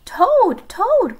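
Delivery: high, loud storybook call; crying out. A narrator's reading. Frog cries out to sleeping Toad, loudly. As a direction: cry out the name to wake him. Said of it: "cried Frog".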